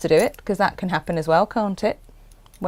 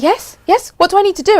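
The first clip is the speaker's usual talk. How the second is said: eager; 12 semitones higher, 4 dB louder than usual. Retold or imitated. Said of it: "You were like".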